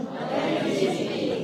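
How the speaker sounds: soft unvoiced whisper. Real, not acted. Speaker answering her own question, soft whisper. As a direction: breathe the word out.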